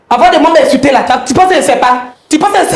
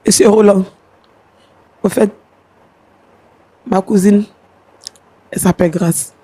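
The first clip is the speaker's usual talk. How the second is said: low, quiet, shaken recounting; subdued voice fighting back emotion; shaken, emotional; 9 semitones lower, 3 dB quieter than usual. Real, not acted. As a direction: speak low, still in shock. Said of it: "elle est vraiment sous l'émotion".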